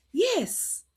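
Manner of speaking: normal talking voice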